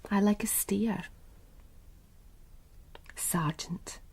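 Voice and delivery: breathy, unvoiced come-on, leaning in close; sultry whisper. Woman whispering suggestively to the sergeant, sultry whisper. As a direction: whisper it like a private invitation.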